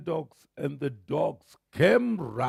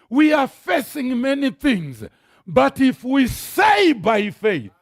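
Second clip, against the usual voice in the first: loud, high preaching with slowed punches; preaching at full force; 6 semitones higher, 7 dB louder than usual. Acted. Preacher rousing the congregation about faith, loud and fervent. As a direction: drive the faith message home to the crowd.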